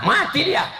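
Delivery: sudden high yelp of disbelief; mock shocked outcry. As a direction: cry out as if the child is doomed. Mock horror at soft parent.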